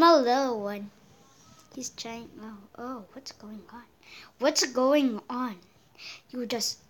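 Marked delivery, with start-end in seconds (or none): 1.7-3.8 very soft, low aside; quiet puzzlement. Real, not acted. Puzzled aside during game. 4.4-5.6 slow question at normal volume; drawn-out puzzlement. Real, not acted. Repeats puzzled question more forcefully.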